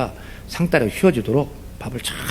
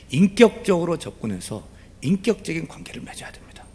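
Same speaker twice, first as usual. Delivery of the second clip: normal talking voice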